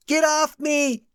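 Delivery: high, terrified cry off; scared scream. A narrator's reading. Mary being dragged, screaming in fear.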